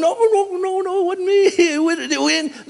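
very high cartoon dog denial; dog insisting it wasn't him; pleading dog. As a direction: yelp out the denial. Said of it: "I can imagine the dog going".

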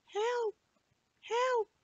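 tiny, very high cry for help from far off; a small voice calling weakly from outside; faint cry. Acted. Melting biscuit calling up from below the window in a tiny, very high voice. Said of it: "the voice came again".

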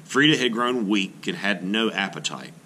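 ordinary talk

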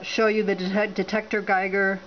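ordinary delivery

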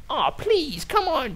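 put-on high whiny kid begging. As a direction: beg like a little kid.

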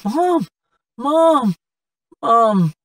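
high, loud desperate calls for mother; frantic cries of mom; calling out. Acted. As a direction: shout across the cemetery for his mother. Son searches for his mother.

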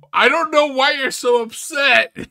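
high, loud incredulous shout; exasperated shout. Real, not acted. Commentator yelling at the upset customer, exasperated shout. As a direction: shout it in disbelief at the fuss. Yells back at upset caller.

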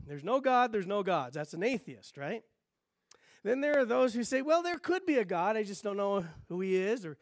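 ordinary talk, nothing marked

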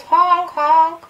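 high, loud playful horn honks; double mock honk. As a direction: honk twice like a car. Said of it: "sounded like the Roadrunner".

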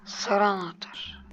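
slow whisper. Real, not acted. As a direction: whisper it slowly. Sneaking quietly.

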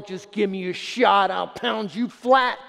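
slow, hammed-up menacing shout; mock threatening God. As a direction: caricature a God itching to crush you. Mock angry God voiced.

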